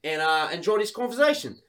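put-on Aussie, politely winding up; Australian accent. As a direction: excuse yourself in a mock Australian accent. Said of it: "She thinks I'm from Australia".

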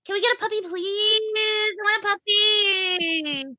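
put-on high, slow whiny kid voice; childlike begging. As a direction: beg like a little kid for a puppy.

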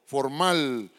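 ordinary talk, nothing marked

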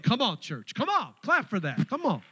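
loud, high call to clap; rallying the church. Real, not acted. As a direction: fire up the crowd. Rallying the congregation.

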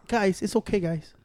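soft mock calming. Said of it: "He's like".

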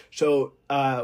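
ordinary talk, nothing marked

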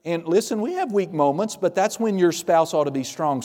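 ordinary delivery

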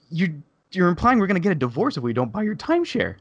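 high-pitched, indignant reenactment. Retold or imitated. Confronting saleswoman over divorce remark, indignant. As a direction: push the pitch up in retold outrage. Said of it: "I pretty much raised my voice at her".

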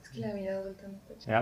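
soft, tired murmur; quiet. Real, not acted. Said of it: "hable más fuerte porque estamos grabando". (en voz baja)

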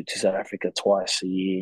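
usual speaking voice

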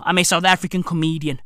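ordinary delivery

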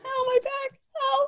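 high strained laughing moan; laughing groan. Real, not acted. Pain from laughing too hard.

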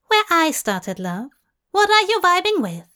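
high, loud, fast eager question; brisk Tracy. A narrator's reading. Tracy quizzing Crowley on his vibe, high, loud and fast. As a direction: rattle it off keenly.

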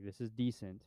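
ordinary talk, nothing marked

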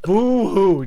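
mock sobbing through laughter; a hammed-up boo hoo; fake crying. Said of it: "Oh, poor you".

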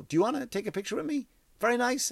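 high-pitched, put-on polite offer; mock gracious celebrity. Celebrity offers a fan a picture, mock gracious celebrity.

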